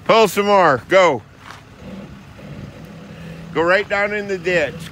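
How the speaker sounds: rally the team from a distance; calling out. Real, not acted. Calling crew to pull.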